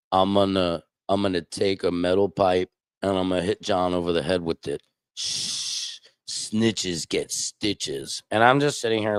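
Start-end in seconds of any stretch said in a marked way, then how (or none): 0.1-4.8 lightly voiced New York tough guy, low; New York gangster. Retelling Jim's threat in a light New York gangster voice. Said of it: "kinda talks like this, like a gangster". 5.2-8.2 soft, slow whispered warning; hushed gangster threat. Retold or imitated. Jim warning him quietly, hushed and slow. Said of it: "talks like this, like a gangster".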